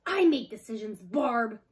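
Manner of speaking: loud, curt wolf leader; a wolf leader snapping at a doubting packmate. A narrator's reading. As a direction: cut her off sharply. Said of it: "snapped the wolf leader".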